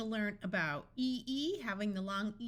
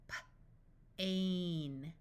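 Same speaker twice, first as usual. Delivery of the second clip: puffed P, pause, then "ain"; sounded out. Shown as a demonstration. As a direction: break "P-, ain" into separate sounds. Phonics teacher sounds out, then blends.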